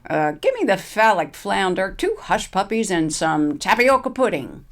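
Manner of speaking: a lightly voiced old woman ordering; Wilma's voice. Wilma orders at the serving line, lightly voiced. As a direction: order lunch with a snicker.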